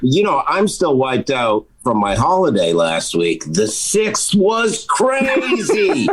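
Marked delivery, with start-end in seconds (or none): 3.7-6.1 laughing. Real, not acted.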